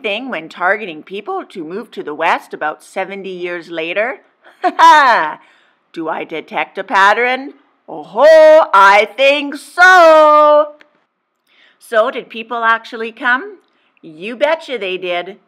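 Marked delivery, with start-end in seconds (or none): 4.6-5.4 loud, higher hammed-up ha ha; a cheeky villainous chuckle; gleeful laugh. 7.9-10.7 loud, higher and slow, savoring it; hammed-up gleeful oh ho. Gleeful reveal of pattern.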